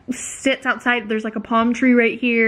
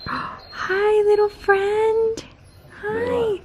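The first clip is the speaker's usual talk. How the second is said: high, slow cooing hello; cooing animal greeting; 10 semitones higher, much slower than usual. Real, not acted. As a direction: coo sweetly to a tiny animal. Greeting a squirrel at the window.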